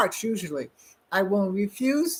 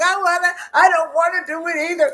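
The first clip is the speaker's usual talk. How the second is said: whiny refusal; 9 semitones higher, 5 dB louder than usual. Retold or imitated. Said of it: "I'm like".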